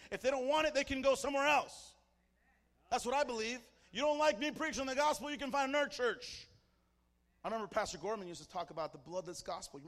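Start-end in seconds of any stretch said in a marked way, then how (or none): 0.1-6.5 raised, loud pulpit defiance; defiant preaching. Real, not acted. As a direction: throw the challenge out to the congregation. Preaches defiantly at full force.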